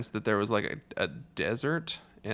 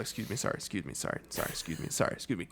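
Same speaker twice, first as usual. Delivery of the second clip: apologetic mumble; 4 semitones lower, 7 dB quieter, much faster than usual. Pretend. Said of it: "I would get up like".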